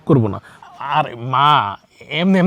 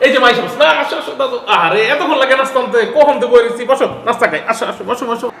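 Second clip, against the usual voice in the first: high, loud, overly cheerful welcome; forced cheer; 9 semitones higher, 3 dB louder than usual. Acted. Son loudly welcoming mother to cover up his wife's threat. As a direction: cover the awkward moment with brightness.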